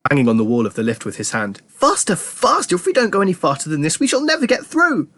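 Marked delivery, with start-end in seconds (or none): 1.7-5.1 high, urgent urging; impatient, high-pitched exclamation; excited cry. A narrator's reading. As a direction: urge them on breathlessly high. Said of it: "cried Mister Wonka".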